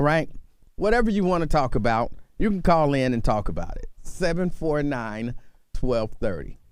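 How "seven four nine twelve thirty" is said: slow number read. Acted. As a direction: read the phone number slowly. Repeating call-in number.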